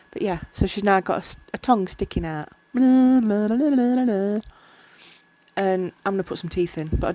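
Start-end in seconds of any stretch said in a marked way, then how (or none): 2.7-4.4 mock blah blah. Voicing the tongue sticking out.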